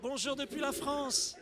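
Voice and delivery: loud, high, slow greeting; excited greeting. Real, not acted. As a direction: call out warmly to faraway viewers. Greeting viewers on screen.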